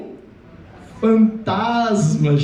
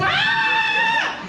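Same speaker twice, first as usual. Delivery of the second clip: long high-pitched terrified shriek; sustained, piercing mock-terror scream; desperate scream. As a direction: scream in hammed-up panic. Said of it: "Estavam gritando, desesperado".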